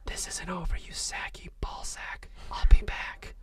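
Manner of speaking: breathy unvoiced threat; whisper. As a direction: whisper it menacingly in his ear. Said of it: "whispered into it".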